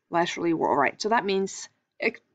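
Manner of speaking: usual speaking voice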